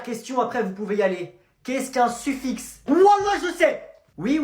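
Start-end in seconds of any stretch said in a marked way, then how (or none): 2.9-3.9 high, loud eager answer; keen pupil calling out; eager shout. Acted. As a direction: blurt it out eagerly.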